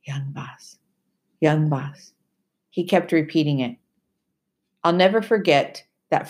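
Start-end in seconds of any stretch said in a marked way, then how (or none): 0.0-2.1 whispered, soothing and slow; low and soft. Acted. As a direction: hush the boy tenderly. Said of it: "said low and soft".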